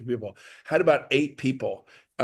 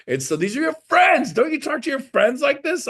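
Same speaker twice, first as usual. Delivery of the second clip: much higher, louder teasing disbelief; mock disbelief; 12 semitones higher, 4 dB louder than usual. Real, not acted. To the audience about talking to friends, much higher mock disbelief. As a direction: act amazed at the crowd.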